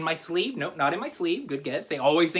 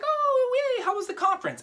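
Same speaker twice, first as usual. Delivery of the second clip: much higher, squealing delighted wife; a wife gushing over her husband's return; cheery wife. Retold or imitated. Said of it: "his wife's like".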